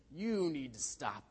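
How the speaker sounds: slow, drawn-out mock scolding; mock telling-off, very slow; parrot mimicking a scolding. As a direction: stretch the words out in a mimicking rebuke. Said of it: "he shook its wing at him".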